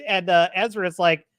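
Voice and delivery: usual speaking voice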